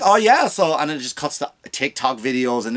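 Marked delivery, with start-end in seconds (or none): 0.0-0.8 influencer voice. Retold or imitated. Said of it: "cut to some influencer".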